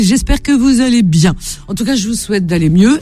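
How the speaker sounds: ordinary talk, nothing marked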